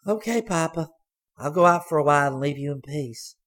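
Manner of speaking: put-on high, bright girl's voice; young girl. A narrator's reading. Daughter sweetly leaving her blind father alone.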